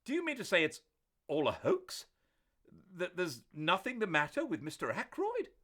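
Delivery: can't believe it's a hoax; astonished disbelief. A narrator's reading. Doctor astonished the murder call was a hoax.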